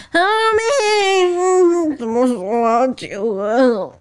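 high, slow, wailing mock of a drunk friend; imitating the tipsy friend. Imitating the tipsy best friend asking Ha-mei about her wedding, high and slow. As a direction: ham up the tipsy girlfriend, dragging every word. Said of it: "我要模仿".

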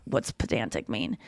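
quiet, embarrassed question; a soft, low, embarrassed aside; sheepish near-whisper. Retold or imitated. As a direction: ask it under your breath, sheepish. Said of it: "I'm like".

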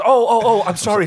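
high, loud, flustered "oh oh oh"; imitating a caught-out producer backpedalling fast; flustered apology. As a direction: Brad caught ogling, apologizing to Ben in a fluster. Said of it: "And he goes".

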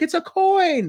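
high, excited mimicry bursting with enthusiasm; Gary. Host mimicking a colleague's find announcement, pure excitement. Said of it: "he loves the enthusiasm in Gary's voice".

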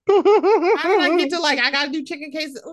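baffled at her own craving; incredulous self-quote. Retold or imitated. Quoting her own inner voice.

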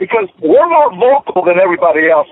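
ordinary delivery

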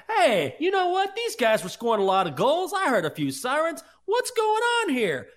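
high, loud teasing imitation of co-host; excitable send-up of a clueless sports take; mock hockey reporter. Mimics co-host's hockey report.

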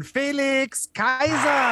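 drawn-out high boxing ring call; ring announcer. Acted. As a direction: stretch the name like a fight announcer. Introducing guest like ring announcer.

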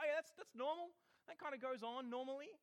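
imitating people finding it all normal; mock nonchalance. Mimicking everyone shrugging off a miracle.

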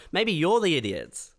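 normal talking voice

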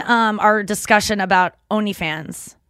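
ordinary delivery

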